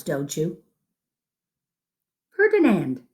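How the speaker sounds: plain reading voice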